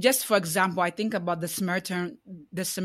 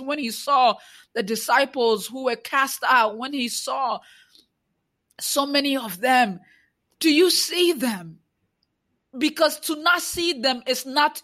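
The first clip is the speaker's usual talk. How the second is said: impassioned preacher, higher and louder; preaching; 5 semitones higher, 3 dB louder than usual. Acted. Challenging Christians who do not see the oppressed, preaching. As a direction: preach it, pressing each question.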